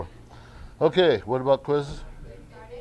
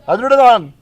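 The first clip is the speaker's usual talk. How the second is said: loud, high drawn-out yell of ADRIAN; yelling a name; 11 semitones higher, 14 dB louder than usual. Real, not acted. Teacher playfully calls out a pupil's name like them, yelling. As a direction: yell the name out, pitch leaping way up. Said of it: "whenever you want to yell his name".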